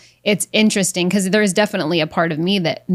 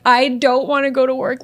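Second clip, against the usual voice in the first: lightly imitated teary whine, higher; a girl whining through fake tears in her car; mock crying whine; 5 semitones higher than usual. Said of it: "I literally crying".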